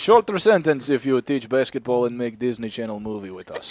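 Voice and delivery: mock Russian official.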